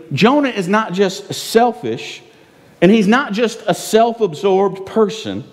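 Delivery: ordinary talk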